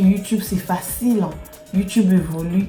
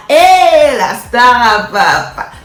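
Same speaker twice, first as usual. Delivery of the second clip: calling out; 3 semitones higher, 8 dB louder, much slower than usual. Real, not acted. Vlogger hyping her own nickname, loud drawn-out call. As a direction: holler it proudly, stretched out.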